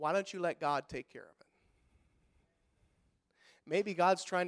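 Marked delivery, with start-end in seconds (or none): none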